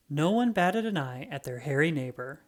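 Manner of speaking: ordinary delivery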